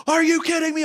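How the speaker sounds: high, loud thrilled disbelief; excited disbelief. Retold or imitated. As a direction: squeal it like a kid who can't believe it. Kid reacting to surprise tickets.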